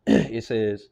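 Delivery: normal talking voice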